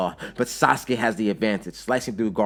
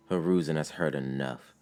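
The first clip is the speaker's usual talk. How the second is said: plain reading voice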